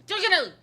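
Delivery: high, loud slurred Definitely; garbled Harry shout. Retold or imitated. Harry mangling his floo destination in a high shout. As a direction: blurt Definitely as if the word breaks down. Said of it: "has a stroke mid-sentence".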